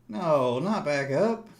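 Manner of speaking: high, loud no; alarmed protest. Real, not acted. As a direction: protest the reversal. Price reversing.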